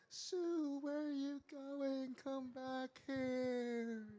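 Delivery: high, long-drawn distant call; calling from afar. A narrator's reading. Parents call after their runaway daughter, drawn-out calling. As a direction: hold each word high, as if calling across fields.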